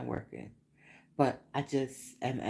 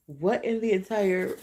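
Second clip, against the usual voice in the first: ordinary delivery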